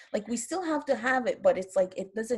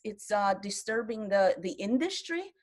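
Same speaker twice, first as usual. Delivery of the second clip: ordinary talk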